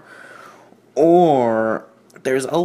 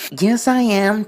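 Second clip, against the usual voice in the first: much higher and louder, a put-on engine voice; Twilight's voice; 12 semitones higher, 6 dB louder than usual. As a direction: snap it out high and proud as Twilight. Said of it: "I'm getting these accents confused now".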